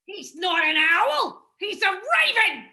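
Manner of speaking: high, loud panicked shouting; panicked yell. Acted. Heffalump yells at Owl while dueling. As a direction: yell in terror at the attacker.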